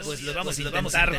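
usual speaking voice

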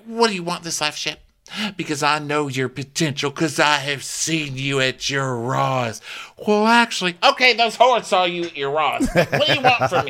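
high, rising-to-loud mock Taylor. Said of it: "And she's like".

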